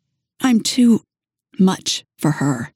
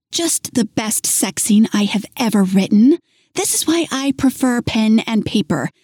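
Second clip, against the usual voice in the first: lightly raised voice, exasperated woman; hint of an upset girlfriend; frustrated young woman; 6 semitones higher than usual. A narrator's reading. Narrator voices Melanie.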